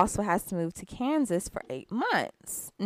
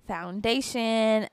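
ordinary delivery

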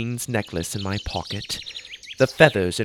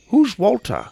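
lightly voiced puzzled father; 11 semitones higher, 4 dB louder than usual. Confused father asks.

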